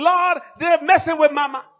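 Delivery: loud, high complaint to God; anguished loud protest; distressed outcry. Acted. Acted outburst at God.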